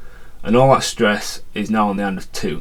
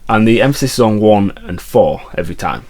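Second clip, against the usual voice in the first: normal talking voice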